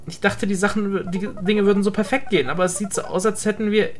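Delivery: mild sad game friend; sad apology. Acted. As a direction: apologise quietly, confused and hurt. Voices Klaus sad apology.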